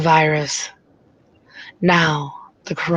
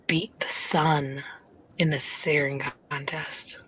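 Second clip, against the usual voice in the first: soft unvoiced whisper; 8 dB quieter than usual. Real, not acted. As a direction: whisper the joke. Whispered joke punchline.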